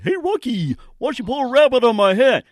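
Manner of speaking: high, goofy cartoon moose voice calling to Rocky; cartoon moose impression. Host doing a cartoon moose for his radio guests, high and goofy. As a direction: play the dim, cheerful cartoon moose. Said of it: "I hope I did a good one right there".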